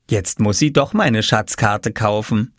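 plain reading voice

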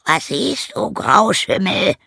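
very high, drawn-out rooster voice; rooster character. Acted. Rooster asks the donkey at the window, high rooster voice. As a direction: pitch the question way up.

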